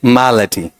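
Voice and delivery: मालटी with weight up front, a reduced middle and falling end; authentic pronunciation. Shown as a demonstration. Teacher models the real pronunciation, मालटी front-stressed and falling. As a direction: hit the first syllable of मालटी, swallow the middle. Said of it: "real में क्या कहा जाएगा".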